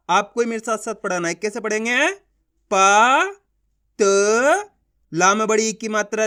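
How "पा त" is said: पा and त each held long, rising, with a pause between; louder, drawn-out पा ... त; syllable by syllable. Shown as a demonstration. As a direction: sound out पा, then त, separately. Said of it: "एक अक्षर का उच्चारण करके".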